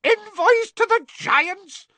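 very high, slow, loud shriek; shrieking Fudge. A narrator's reading. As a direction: shriek it in disbelief. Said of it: "Fudge shrieked".

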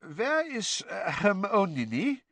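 put-on high, slow Eastern European accent; hesitant question in a thick Bulgarian-style accent; Krum's foreign accent. A narrator's reading. Krum looking for Hermione at the table, foreign accent. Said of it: "Krum had just arrived".

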